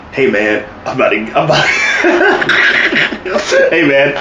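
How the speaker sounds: halting quote breaking into laughter; imitated wrestler. Co-host imitating Heath Slater's table talk, laughing imitation. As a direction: start the impression, then crack up. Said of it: "As Heath Slater, um, is like".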